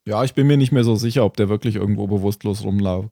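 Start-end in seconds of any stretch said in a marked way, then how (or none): none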